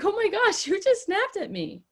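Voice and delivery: high, gushing mock hurt; overly shocked. Shown as a demonstration. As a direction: gasp it out like you're wounded by it. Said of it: "don't be like".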